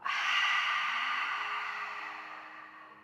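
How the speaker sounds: airy voiceless sigh out of the mouth; breathy sigh. Shown as a demonstration. Teacher sighing along with the class to release. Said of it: "And sigh it out".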